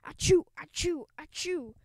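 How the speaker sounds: high, loud mock sneezing; mock sneezes. A narrator's reading. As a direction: sneeze over and over. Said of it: "The cheerleaders sneezed and sneezed".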